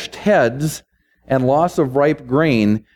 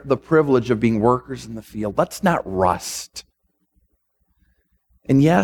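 ordinary talk, nothing marked

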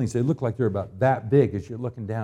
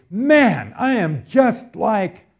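confessing sinner cry; 8 semitones higher, 8 dB louder, much slower than usual. Retold or imitated. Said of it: "And so then you say".

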